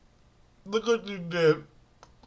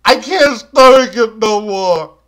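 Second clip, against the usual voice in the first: usual speaking voice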